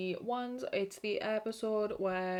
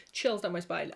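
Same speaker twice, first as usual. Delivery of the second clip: usual speaking voice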